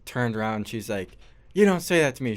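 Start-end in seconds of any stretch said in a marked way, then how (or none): 1.5-2.3 raised, sharp scolding voice; yelling. Retold or imitated. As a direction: call it out like a scolding teacher. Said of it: "started yelling at him".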